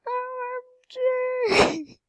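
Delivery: very high, drawn-out wail; overjoyed squeal. Real, not acted. Player overwhelmed by a million, overjoyed high squeal. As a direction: squeal the letters slowly, way up high.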